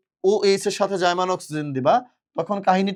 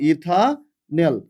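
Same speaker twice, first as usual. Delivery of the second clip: Ethanal stretched slowly, each syllable held; teacher spelling out ethanal slowly; slow and drawn out; much slower than usual. Shown as a demonstration. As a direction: drag out ethanal for the class to copy. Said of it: "কি বলবো?".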